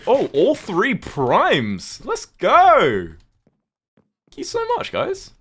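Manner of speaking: high, loud burst of delighted thanks; excited thanks. Real, not acted. Streamer thanking chat for primes, excited high squeal. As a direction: squeal your thanks, really thrilled. Thanking viewers for gifted subs.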